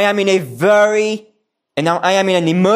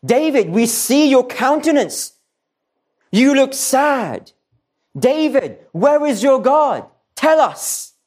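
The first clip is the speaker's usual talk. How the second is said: higher, mocking taunts thrown at David; taunting enemies; 6 semitones higher than usual. Preacher voicing David's enemies jeering at him, high mocking taunt. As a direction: sneer at him, needling him over his God. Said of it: "his enemies are saying to him".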